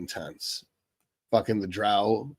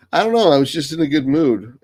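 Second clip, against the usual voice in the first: giggly excitement; 5 semitones higher, 6 dB louder than usual. Real, not acted. Laughing at his own accent.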